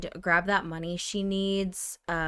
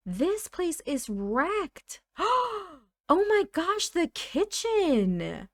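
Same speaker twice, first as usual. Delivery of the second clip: high, gasping disbelief; shocked; 9 semitones higher, slower than usual. Real, not acted. Player discovering the wrecked kitchen, shocked. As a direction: gasp and cry out in shock.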